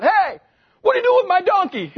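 high, loud indignant shout; owner's shout. Acted. Owner catches disciples untying his donkey, shouting high and loud. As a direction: yell after the thieves from the doorway. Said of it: "you hear the shout".

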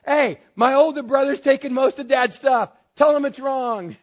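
high, loud complaining demand; gripe, feeling cheated; whiny younger brother. Acted. The younger brother complains to Jesus, high, loud and whiny. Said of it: "this guy is".

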